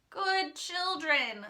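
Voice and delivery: slow, high wheedling plea; begging wolf. A narrator's reading. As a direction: coax them like a sly wolf. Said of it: "the wolf begged".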